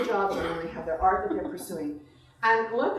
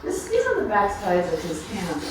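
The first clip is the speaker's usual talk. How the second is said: slow, louder, unhurried reading to a room of guests; story reading; 5 dB louder, slower than usual. Acted. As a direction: let each line land like a remembered story. Reads her story aloud to guests.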